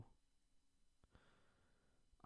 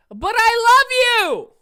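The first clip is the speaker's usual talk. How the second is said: much higher, loud, slow, drawn-out cry; mock heartbroken wail; 14 dB louder, much slower than usual. Wailing after his cat walks away, mock heartbroken. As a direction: wail it like a jilted lover.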